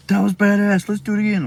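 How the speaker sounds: high, loud burst of glee; imitated thrilled kid. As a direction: exclaim it like a hyped-up little brother.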